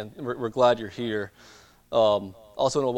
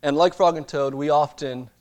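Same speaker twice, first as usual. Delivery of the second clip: preaching; 5 semitones higher, 5 dB louder than usual. Acted. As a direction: drive the point home from the pulpit. Preaches the lesson of temptation.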